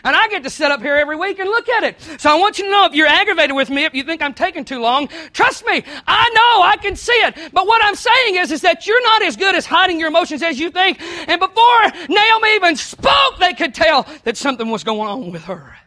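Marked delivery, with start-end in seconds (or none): 2.2-14.1 loud, high preacher's voice, pressing hard; preaching at full force. Acted. Pastor warning the congregation about their faces, loud and high. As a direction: drive it home to the pews.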